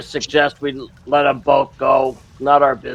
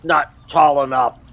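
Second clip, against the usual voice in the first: normal talking voice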